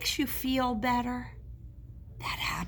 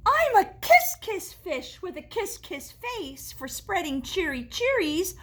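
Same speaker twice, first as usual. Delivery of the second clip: joyful refrain; 6 semitones higher, 6 dB louder than usual. Acted. Fish's happy refrain.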